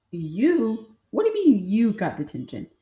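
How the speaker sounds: loud, disbelieving mock outburst; incredulous grandma. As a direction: imitate a grandma who can't believe it.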